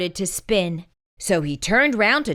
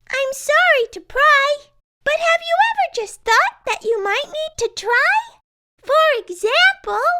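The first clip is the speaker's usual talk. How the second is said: much higher, louder, cheery tin friend; bright Tess; 4 dB louder than usual. A narrator's reading. Tess coaxing.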